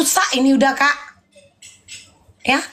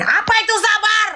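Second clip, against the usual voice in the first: outraged yell at being told to be patient; furious high-pitched yell; angry shout; 8 semitones higher than usual. Real, not acted.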